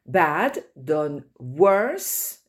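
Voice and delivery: «worse» said very slowly with careful English vowels; careful English model. Shown as a demonstration. Teacher modelling vocabulary for French learners, very slow and careful. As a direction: stretch out «worse» slowly so students hear each sound. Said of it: "teacher models English pronunciation".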